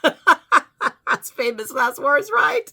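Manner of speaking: high, loud laughing voice. Real, not acted. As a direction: say it through real laughter, slowly. Laughing at her own remark.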